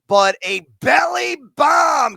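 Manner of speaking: loud, slow, hyped call; excited shout. Real, not acted. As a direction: belt it out with excitement.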